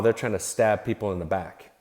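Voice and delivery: usual speaking voice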